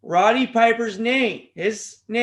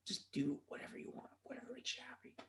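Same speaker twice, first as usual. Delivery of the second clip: dismissive whisper; 22 dB quieter, faster than usual. Real, not acted. Muttering dismissively, voice dropping.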